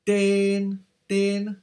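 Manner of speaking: Ten held high and flat like a sung la; flat musical tone. Shown as a demonstration. Teacher shows the level tone of Ten to students. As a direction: sing Ten on one high level note. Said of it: "qui se lit ten".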